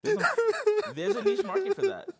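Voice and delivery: very high, loud teasing squeal. Acted. Teasing squeal about niche market.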